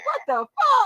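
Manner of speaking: high, shocked exclamation; shocked friend. Retold or imitated. Mocking her friend's shocked reaction, high and surprised. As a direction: mimic her stunned outburst. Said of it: "Girl, you was like".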